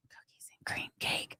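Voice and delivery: very soft, secretive whisper; hushed secret whisper. Real, not acted. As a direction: whisper it so nobody overhears. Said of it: "I can't say it too loud".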